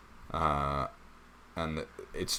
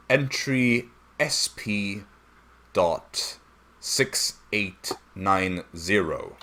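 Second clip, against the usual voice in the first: slow deliberate reading; 4 semitones higher, 7 dB louder, much slower than usual. Acted. Octavian reads log entry aloud.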